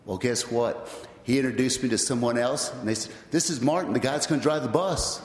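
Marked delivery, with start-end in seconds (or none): 3.3-5.2 higher-pitched retold announcement; eager introduction. Chad presenting the new bus driver, eager introduction. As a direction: introduce him brightly, pitch lifted to the end.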